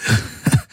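breathy, hoarse little laugh through tears. A narrator's reading. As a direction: laugh out of an exhausted throat. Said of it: "aber er lachte heiser". (heiseres Lachen unter Tränen)

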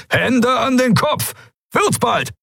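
very high, piercing, impatient order; shrill command. A narrator's reading. Pirate repeating the order to stalling visitors, shrill and impatient. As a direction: let the order ring shrilly through a hangar. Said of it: "Der Befehl gellte durch den Hangar".

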